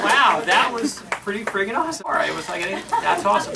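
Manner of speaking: overwhelmed wow. Real, not acted. Overwhelmed reaction after reunion.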